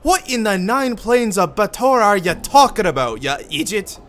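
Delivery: Irish voice mocking an idiot; Irish accent. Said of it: "I gotta get Irish".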